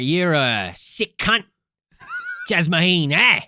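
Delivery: broad mock Australian, slow and drawn out on the insult; Australian accent. Podcaster mimicking the brutal reporter in a broad Australian accent. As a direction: play a rough Aussie TV reporter laying into her. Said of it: "I'm Australian".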